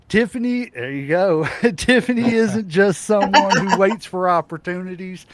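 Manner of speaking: loud, laughing hype; excited laughing intro. Real, not acted. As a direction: cheer her in with a laugh.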